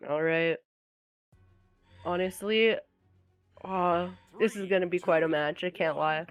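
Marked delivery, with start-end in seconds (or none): none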